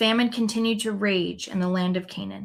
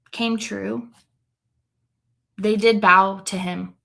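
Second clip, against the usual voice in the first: plain reading voice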